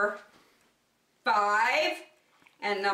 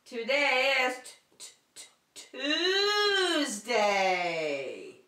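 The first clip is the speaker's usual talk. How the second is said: teasing suspense; 3 semitones higher, much slower than usual. Acted. Suspenseful reveal of the day.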